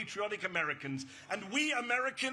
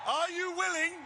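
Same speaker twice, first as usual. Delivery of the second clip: raised, higher rally shout; louder call to the crowd; 6 semitones higher than usual. Real, not acted. Rally speaker urging the crowd to answer, shouting. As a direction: push it out to the back of the crowd. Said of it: "I need to hear you".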